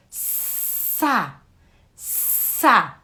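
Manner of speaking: first Sa hissed almost voiceless, then sa voiced; syllable sound. Shown as a demonstration. Teacher repeating a syllable for a child. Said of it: "agora você já sabe esse som".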